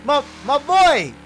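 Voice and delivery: loud, high call across the track; loud high shout to a lagging friend. Real, not acted. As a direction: holler for your buddy who's fallen behind. Calling out to friend's car.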